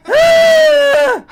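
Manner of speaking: very high, loud scream; hammed-up shriek of horror; terrified shriek. Second robber seeing the girl's face, shrieking.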